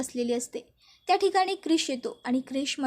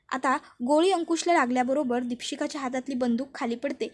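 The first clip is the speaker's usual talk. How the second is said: ordinary delivery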